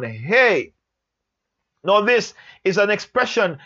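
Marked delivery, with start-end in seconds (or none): 0.0-0.7 brief call with a falling tone. Shown as a demonstration. Teacher acting out a man hailing someone, calling out. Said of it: "It's calling out someone".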